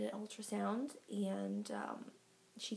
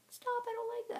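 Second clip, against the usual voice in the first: put-on high-pitched imagined baby voice; playful high-voiced little complaint; protesting baby; 14 semitones higher, faster than usual. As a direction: squeal it like a tiny, objecting baby. Voices unborn baby protesting kicks.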